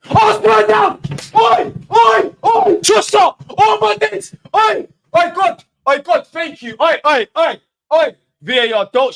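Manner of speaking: screaming high and loud in celebration; ecstatic goal shout. Real, not acted. Fan reacting to his team's goal, ecstatic shouting. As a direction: erupt at the goal, yelling at full pitch.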